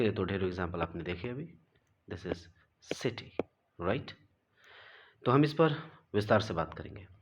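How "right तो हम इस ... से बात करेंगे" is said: ordinary talk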